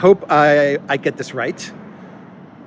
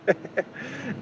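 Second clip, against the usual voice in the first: short breathy laugh; 6 semitones higher, 10 dB quieter than usual. Real, not acted. Mocking the Swedish politician.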